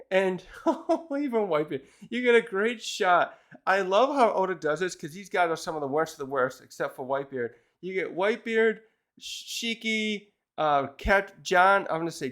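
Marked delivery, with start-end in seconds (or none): none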